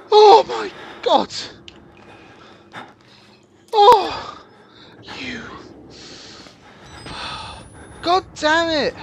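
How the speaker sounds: high, loud frantic swearing; panicked cursing. Real, not acted. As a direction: curse in terror while fleeing. Chased by monster, cursing.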